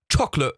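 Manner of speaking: CHOCOLATE cut off before its final T; ugly accent imitation. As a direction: mimic CHOCOLATE with no T at the end. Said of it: "So they say".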